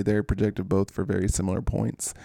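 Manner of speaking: normal talking voice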